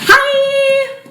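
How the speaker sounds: bright, loud high hello to the camera; perky greeting. Acted. Disorder greeting the audience, perky and high. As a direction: chirp it like a cheery host.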